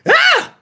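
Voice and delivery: high-pitched pain yelp; short shriek, acting out a sting; acted holler. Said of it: "I holler".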